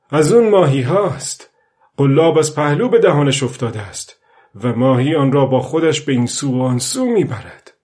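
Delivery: a fisherman's thrill at a big catch; excited old fisherman. Acted. Old man feeling the fish take the bait, excited.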